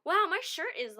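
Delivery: high, loud mock distraction; imitating a friend dodging awkwardly; mock change of subject. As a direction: blurt it to dodge. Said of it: "he tried to change the subject".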